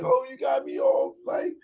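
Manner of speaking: put-on very high breathy girly squeal; flustered girl's squeal. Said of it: "she's like".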